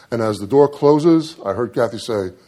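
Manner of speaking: ordinary talk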